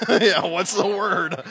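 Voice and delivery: laughing. Pretend. Joking slang.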